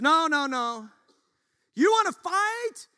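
high, loud preacher's challenge; voicing a fired-up rebuke; fiery challenge. Preacher voices Paul's fiery challenge.

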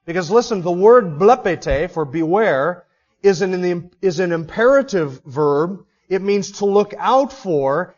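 ordinary talk

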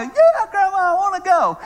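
put-on high, loud, eager child voice; excited little granddaughter. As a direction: squeal it like a thrilled little girl. Granddaughter excited about beach trip.

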